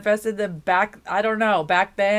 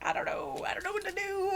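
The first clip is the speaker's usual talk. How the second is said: mock whimper; 10 semitones higher, 9 dB quieter, faster than usual. Mock crying indecision.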